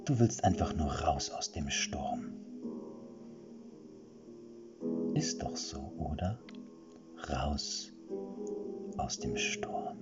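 soft, seductive whispering voice in his head; whispered. Acted. Witch's voice whispers inside Erik's head, seductively soft. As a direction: slowly whisper his longings to lure him. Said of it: "flüsterte die Stimme in seinem Kopf".

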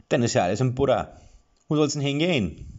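breezy and helpful; friendly dwarf. A narrator's reading. Friendly dwarf asks where the heroes go, fast and high. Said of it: "Seine Miene war freundlich".

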